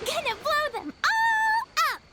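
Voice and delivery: high, gleeful mischievous cry; gleeful excitement. Acted. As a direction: shout it like a kid with bombs. Eager to bomb everything.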